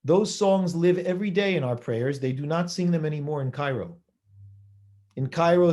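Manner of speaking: normal talking voice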